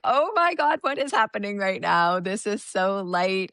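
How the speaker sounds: high, excited Oh my God; amazed exclaim. Retold or imitated. Speaking fairy for the first time, high and amazed. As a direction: burst out in giddy wonder. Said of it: "I was like".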